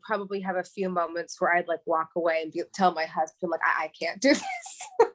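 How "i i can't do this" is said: breaking into a laugh mid-line, voice jumping up. Pretend. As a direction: laugh through the admission that you can't cope. Imagined quote to husband, laughing.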